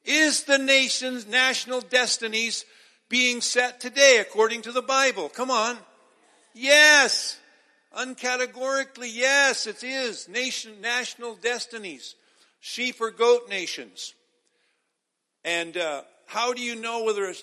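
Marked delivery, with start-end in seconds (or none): 0.0-5.8 loud, high, driving pulpit call; preaching at full force. Acted. Pastor pressing the audience for an answer, loud preaching. 6.5-7.4 shouted, high, ringing yes; emphatic shout. Acted. Pastor answering his own question, loud shout. 8.0-14.1 loud, high, driving pulpit call; preaching at full force. Acted. Pastor pressing the audience for an answer, loud preaching.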